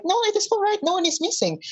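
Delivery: very high, insistent reassurance; hammed-up squeaky cover-up; anxious reassurance. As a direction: brush off the worry in a high voice. Centaur hastily reassures the crowd.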